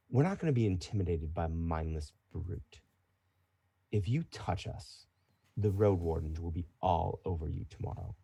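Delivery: low, soft warning with pauses; quiet defiance. Acted. Woman refusing to be intimidated by a brute, quiet threat. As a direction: warn them off without raising your voice.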